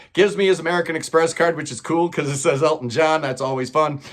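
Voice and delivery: plain reading voice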